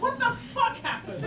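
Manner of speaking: high loud laughing question; laughing quote. Retold or imitated. Doctor asking what happened, voiced high through laughter.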